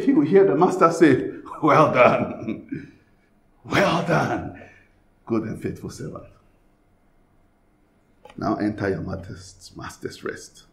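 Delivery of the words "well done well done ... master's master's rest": soft low voice, fading to a whisper at the end; master's welcome. Retold or imitated. God welcoming the servant home, soft and fading. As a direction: gently bless a faithful servant.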